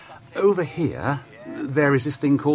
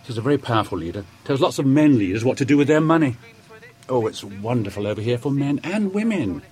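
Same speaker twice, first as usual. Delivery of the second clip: ordinary talk, nothing marked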